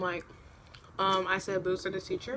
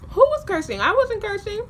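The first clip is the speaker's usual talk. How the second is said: very high, louder mock protest; high-pitched indignant denial; 12 semitones higher, 6 dB louder than usual. Girl silently protests teacher's cursing accusation, high-pitched mock indignation. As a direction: squeal an innocent protest in retelling.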